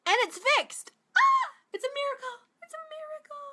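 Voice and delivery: very high falsetto exclaiming a miracle; mock triumphant chorus. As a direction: squeal it like a cheering crowd. Triumph over fixed mistake.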